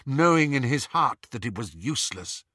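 usual speaking voice

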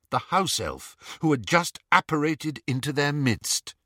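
ordinary delivery, nothing marked

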